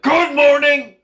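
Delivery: high, loud cheery scream; loud wake-up yell. Retold or imitated. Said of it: "He's gonna be like".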